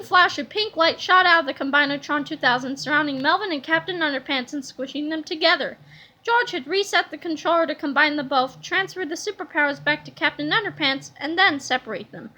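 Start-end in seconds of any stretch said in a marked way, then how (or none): none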